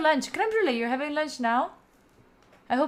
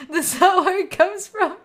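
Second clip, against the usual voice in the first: laughing through the words, louder and higher; 7 semitones higher, 6 dB louder than usual. Real, not acted. Streamer laughing as chat corrects her, higher and louder. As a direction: let the laughter break through.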